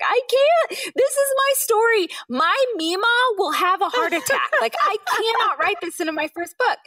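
very high, strained protest; panicked refusal. Retold or imitated. Author refusing publishers in a very high panicked voice. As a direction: squeak out a panicked no.

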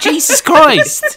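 drawn-out high exasperated cry; exasperated yell. Real, not acted. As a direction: cry out at the ceiling. Exasperated outburst at slow story.

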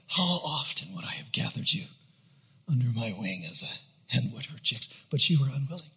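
hushed, low sorrowful plea; weeping lament. Acted. Jesus standing over Jerusalem, quiet mournful lament. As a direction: grieve softly over those who refused. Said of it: "and he's weeping".

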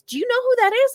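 high-pitched mock disbelief; retold incredulous question. Lawyer asking client about the staring stranger, retold incredulity. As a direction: imitate someone aghast, pitch leaping up. Lawyer's reaction voiced in story.

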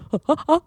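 high guffaw laugh. Shown as a demonstration. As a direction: another guffaw demo. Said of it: "guffaw is kind of like".

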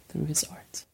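hushed whisper, almost reverent; a soft whispered aside about Stan Lee. Real, not acted. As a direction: drop to a whisper for the tribute. Solemn tribute to Stan Lee.

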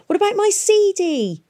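high, loud desperate call; shouted. A narrator's reading. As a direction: yell it after the closing hatch. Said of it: "shouted Tom".

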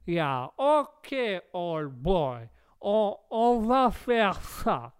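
slow American drawl in French; American accent. Acted. American agreeing with the host, drawling American accent. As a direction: drag each word out, American accent. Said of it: "on va rester à l'accent là".

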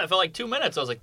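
ordinary talk

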